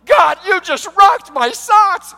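high, loud, slow ecstatic shout; Adam thrilled, shouting his thanks to God; overjoyed shout. Acted. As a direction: yell it out with pure joy. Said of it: "Adam was like".

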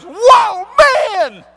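high, loud astonished whoa; Adam stunned at first sight of woman, loud amazed whoa. Acted. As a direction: gasp it out in wonder. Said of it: "he looks and he goes".

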